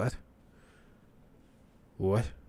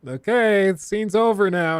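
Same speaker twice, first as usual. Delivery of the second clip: nervous interruption; 7 semitones higher than usual. Retold or imitated. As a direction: gently call the scene to a halt. Crew trying to stop actor.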